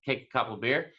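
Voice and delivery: normal talking voice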